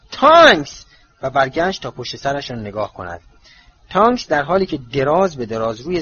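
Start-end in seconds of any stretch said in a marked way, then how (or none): 0.1-0.9 sharp, much higher, louder yell; cross shout. Acted. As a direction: snap her name in anger. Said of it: "با اوقات تلخی فریاد زد". (فریادی تند و عصبانی)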